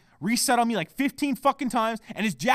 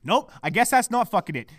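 ordinary delivery

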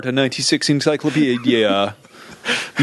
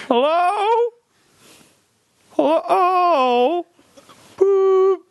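very high, piercing hello; high squeaky voice; much slower than usual. Pretend. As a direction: squeal it far above your voice. High cries into phone.

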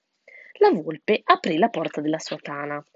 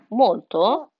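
ordinary delivery, nothing marked

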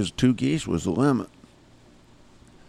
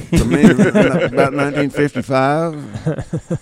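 laughing; 4 semitones higher, 5 dB louder, slower than usual. Real, not acted. Laughs correcting the year.

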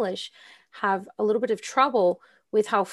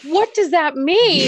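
puzzled students; 8 semitones higher, 8 dB louder, slower than usual. Retold or imitated. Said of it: "And they're like".

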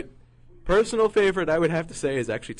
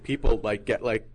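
ordinary talk

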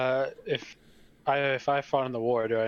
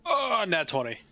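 excited; 7 semitones higher than usual. Real, not acted. Player rolls a natural twenty.

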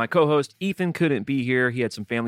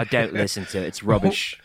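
put-on British accent. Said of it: "that's my British accent".

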